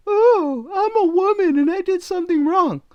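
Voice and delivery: very high falsetto whiny parody; mock whining. Imitating the TikToker excusing what she did, mock whining. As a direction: whine it in a mocking falsetto. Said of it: "What the hell are you whining about?".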